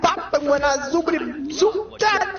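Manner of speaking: high Cosby scat; Bill Cosby impression. Bill Cosby scatting, up high.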